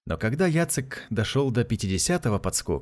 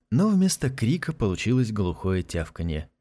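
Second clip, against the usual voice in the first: ordinary delivery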